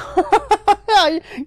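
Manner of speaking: high laugh. Real, not acted. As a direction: burst of high cackling laughter. Wild laugh at a kill.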